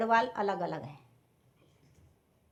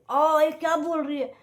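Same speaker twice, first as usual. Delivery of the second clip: retold landlady snapping, higher and louder, slow; sister-in-law scolding the tenant, raised and slow; scolding yell; 5 semitones higher, 5 dB louder than usual. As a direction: mimic the landlady's shocked telling-off. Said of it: "आप तो वैसे मेरे पे चिल्ला रही हो".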